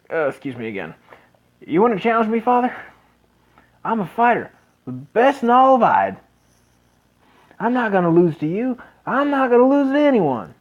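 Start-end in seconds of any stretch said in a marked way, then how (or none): 1.7-10.5 put-on higher, louder young man's voice; eager young son. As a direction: plead with his dad, fired up. Son challenges father.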